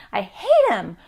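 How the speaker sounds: put-on loud, fed-up outburst from an upset kid; furious child. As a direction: blurt it out angry at a friend.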